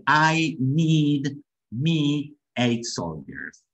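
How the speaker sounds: "I need me eight soldiers" very slow, each word separated. Shown as a demonstration. Teacher showing learners what the lieutenant says, slow word-by-word "I need me eight soldiers".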